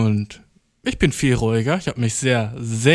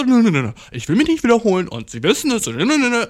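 mocking high voice; 8 semitones higher than usual. Mocking the bosses' reply.

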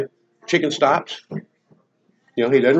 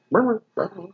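higher mock chicken chirps; chicken brr; 5 semitones higher, 4 dB quieter than usual. Chicken answering the cop, higher mock chirps. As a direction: chirp back like a stubborn chicken. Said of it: "chicken goes".

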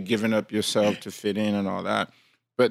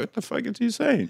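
much higher, baffled voice; high, baffled "what is he saying"; confused listener; 13 semitones higher than usual. Retold or imitated. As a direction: play the lost listener. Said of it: "you're like".

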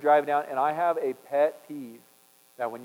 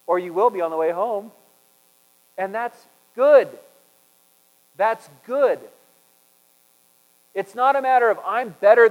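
loud, higher preaching voice, slowing to press it home; full-force preaching; 5 semitones higher, 8 dB louder than usual. Acted. Pastor urging the congregation to repent, loud and fervent.

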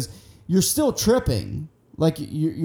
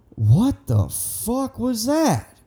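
slow, higher-pitched bewilderment; stunned disbelief; 6 semitones higher, much slower than usual. Retold or imitated. Getting up off hands and knees after the trip, stunned. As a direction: stretch it out, dumbfounded. Retelling his stunned reaction.

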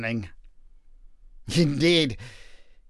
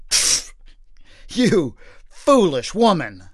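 slow, contemptuous scoff; husband scoffing at his wife; slower than usual. Acted. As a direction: dismiss her with a sneer. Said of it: "scoffed Manoah".

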